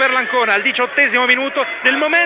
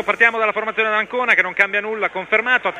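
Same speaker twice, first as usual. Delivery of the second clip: ordinary talk, nothing marked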